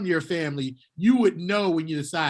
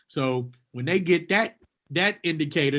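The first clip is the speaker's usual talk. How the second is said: ordinary talk, nothing marked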